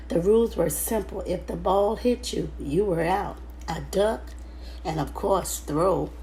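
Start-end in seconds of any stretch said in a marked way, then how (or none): none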